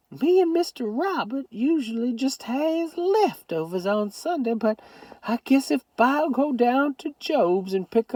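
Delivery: put-on high old Southern grandmother voice; Southern grandma. A narrator's reading. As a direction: scold lovingly as a granny.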